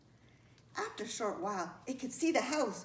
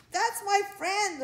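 high, loud, slowed protest; indignant defence; 10 semitones higher, 8 dB louder, slower than usual. A narrator's reading. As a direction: stand up fiercely for a friend. Ladybug confronts child hurting spider.

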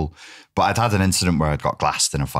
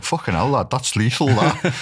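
impressed doorman; 6 semitones higher than usual. Retold or imitated. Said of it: "They'd be like".

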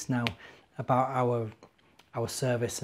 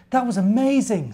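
high, louder gasp of wonder; amazed little bear; 9 semitones higher, 7 dB louder than usual. Acted. Benjamin amazed at the ants, high wondering voice. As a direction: marvel at what just happened.

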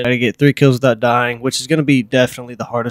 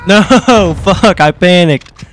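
panicked; 6 semitones higher, 4 dB louder, slower than usual. Real, not acted. Panics in a fight.

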